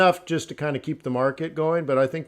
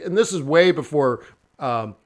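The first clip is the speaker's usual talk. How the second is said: ordinary talk, nothing marked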